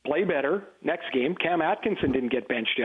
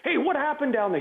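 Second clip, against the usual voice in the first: much higher mock yell down the bench; 9 semitones higher than usual. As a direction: call out down the bench, playing innocent. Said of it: "He'd yell down to".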